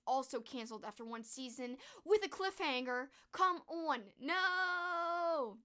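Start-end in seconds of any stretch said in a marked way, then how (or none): 3.3-4.0 high "come on" with a drawn-out "on"; exasperated catchphrase. Real, not acted. Vlogger protesting a cancelled cliffhanger show, exasperated. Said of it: "come on" a lot, too". 4.2-5.6 high, long-held "no"; drawn-out mock protest. Vlogger protesting a cliffhanger cancellation, drawn-out mock wail.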